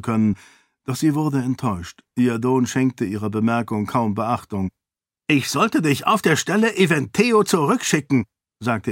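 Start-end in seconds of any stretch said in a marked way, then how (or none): 5.3-8.3 higher, accusing; the enraged king threatens her; angry king. Acted. King threatens to send away. (zorniger König, drohend)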